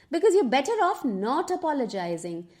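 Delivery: ordinary talk, nothing marked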